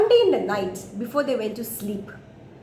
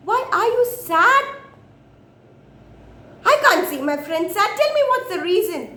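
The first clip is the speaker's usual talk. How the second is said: higher, insistent monkey voice; pitched-up, worried pleading; concerned monkey; 8 semitones higher, 4 dB louder than usual. Acted. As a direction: press a sad friend for the reason.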